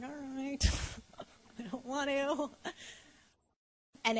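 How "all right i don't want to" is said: small, soft grudging voice; quiet mock reluctance; reluctant giving in. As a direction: mumble it softly like a sulking child. Voices her reluctant reply to God.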